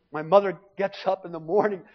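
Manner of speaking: ordinary talk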